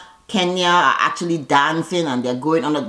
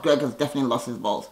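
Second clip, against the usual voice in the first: lower, softer and quick; quiet fast aside; 5 semitones lower, 6 dB quieter, faster than usual. Real, not acted. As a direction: mutter it again as a knowing aside. Repeats the verdict twice.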